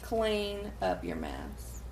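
slow, low and soft; teasing slow repeat. Acted. Co-host repeating the command back to her, slow and low. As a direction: draw the words out slowly and quietly.

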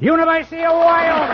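loud, high repeat of the answer; triumphant echo. Real, not acted. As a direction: ring it out like a winner. Host repeats the correct answer.

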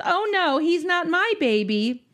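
high, drawn-out mock refusal; amused refusal. Grandmother refusing to help change baby, amused mock refusal. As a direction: imitate a grandmother airily begging off. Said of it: "She just laughs and says".

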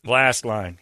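usual speaking voice

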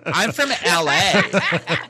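slow, camp LA drawl; hammed-up Californian valley voice; camp American accent. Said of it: "I go a bit camp with that".

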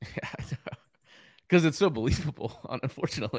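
giggling through the words; can't stop laughing while talking; hysterical laugh. Real, not acted. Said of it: "why are you laughing like that, you weirdo".

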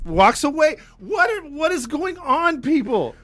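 high, loud, disbelieving; exasperated rant. Real, not acted. Exasperated rant about story.

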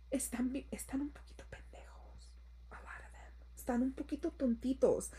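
conspiratorial whisper, teasing; whispered aside. Real, not acted. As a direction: lean in and whisper it like gossip. Gossipy aside about men.

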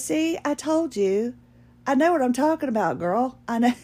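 sassy, high-pitched know-it-all saleswoman; mimicked sassy told-you-so; imitating Vi. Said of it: "she did talk like that".

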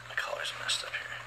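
a quick whisper to herself; under her breath, fixing her collar; whispered aside. Real, not acted. Speaker fixing her collar mid-story, whispered aside to herself.

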